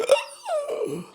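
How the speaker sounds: high, squeaky shocked gasp; a hammed-up gasp of disbelief; imitated gasp; mock gasp. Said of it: "And he just".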